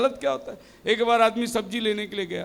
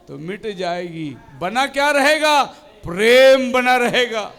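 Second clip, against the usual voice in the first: preaching recital; 3 semitones lower than usual. Acted. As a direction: recite the verses with weight. Recites scripture in sermon.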